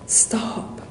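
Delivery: "Stop!" whispered, breath only; whispered echo. Retold or imitated. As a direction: let the second "Stop!" fall to a whisper. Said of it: "he shouts".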